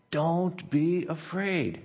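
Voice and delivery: soft, slow comforting promise; gentle reassurance. Acted. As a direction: hush a scared servant with calm. Pastor reading God's comfort aloud.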